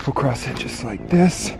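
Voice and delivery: ordinary talk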